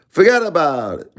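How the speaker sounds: lightly hammed mob tough guy; mock mobster. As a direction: toss off the catchphrase like a goodfella. Mobster catchphrase, comic punchline.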